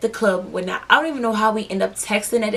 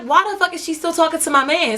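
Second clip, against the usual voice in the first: retold annoyance; 7 semitones higher than usual. Noticing girl still talking to man.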